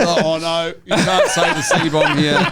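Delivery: hammed-up horrified objection; mock shocked staff.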